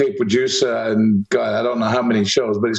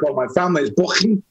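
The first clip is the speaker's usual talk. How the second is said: quick Liverpool Scouse voice; a Scouser mangling the name, Scouse accent; 4 semitones higher, faster than usual. Retold or imitated. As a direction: rattle it off like a Scouser. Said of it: "unless you're a Scouser".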